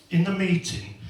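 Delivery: ordinary delivery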